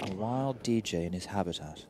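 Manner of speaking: lightly voiced Attenborough narrator; nature documentary. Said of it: "David Attenborough stuff".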